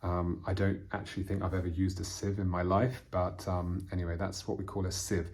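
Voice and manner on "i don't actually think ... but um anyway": ordinary talk, nothing marked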